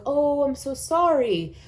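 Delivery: put-on high, slow soothing "oh" to a hurt baby; sympathetic mom. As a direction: coo it like a mother comforting a crying child. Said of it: "mom will walk over and be like".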